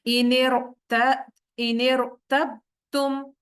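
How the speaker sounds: slow, drawn-out tajweed recitation; Quran recitation. Shown as a demonstration. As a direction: recite each syllable slowly with heavy r. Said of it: "इसकी एक दफा practice करते हैं". (धीमी तिलावत)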